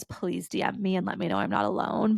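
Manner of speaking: ordinary talk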